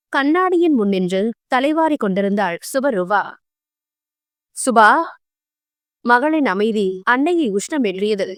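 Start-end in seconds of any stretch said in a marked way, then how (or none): 4.6-4.9 shout. Acted. Mother shouting daughter's name.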